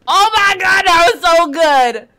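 high, loud gushing praise; overwhelmed, excited cry of praise; loud delight. Real, not acted. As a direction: shout the joy out. Overjoyed after the trailer.

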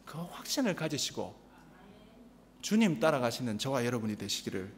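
low, soft prayerful benediction; solemn blessing. Acted. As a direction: close the sermon with a quiet blessing. Sermon benediction.

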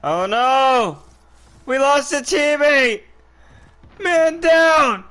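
loud, urgent man-down cry; alarmed callout. Real, not acted. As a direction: yell it to the squad like a soldier. Teammate dies in game.

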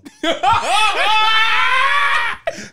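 high, loud, drawn-out guffaw; loud shrieking laugh. Real, not acted. Host hearing about the guest's huge head, shrieking laugh. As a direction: burst out in a shouted laugh.

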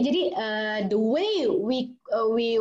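usual speaking voice

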